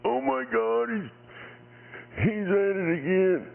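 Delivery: high, whiny hammed-up crybaby voice; mock crybaby. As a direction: whimper it like an overgrown baby, mocking. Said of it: "You go".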